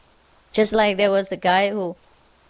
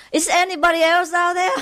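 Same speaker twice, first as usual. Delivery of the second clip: high, quick call into the distance; mock calling out; 8 semitones higher, faster than usual. Doubting man on cliff seeking other help, mock calling out. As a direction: call for someone else, playfully acted. Joke punchline, man calls out.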